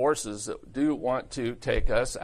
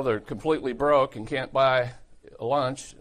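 normal talking voice

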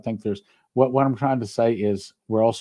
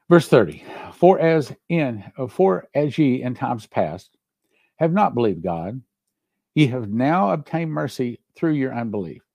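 preaching; 4 semitones higher than usual. Acted. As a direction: proclaim the verses like a preacher. Reads Romans passage with fervor.

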